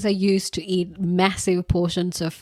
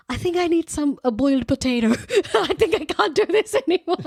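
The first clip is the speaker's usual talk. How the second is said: mock desperate; 10 semitones higher, faster than usual. Quoting her fed-up past self.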